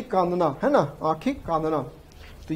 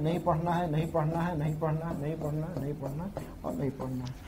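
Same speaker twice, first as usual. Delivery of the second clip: steadily trailing down to very soft; absent-minded chant fading away; fading repetition; 7 dB quieter than usual. Real, not acted. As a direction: repeat it over and over, quieter each time. Repeats it while marking excluded items.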